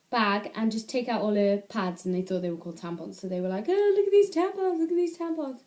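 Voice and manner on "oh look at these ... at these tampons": high teasing schoolboy chant, pitched up; mocking schoolboys. Boys at school taunting a friend over her pads, high mocking voice.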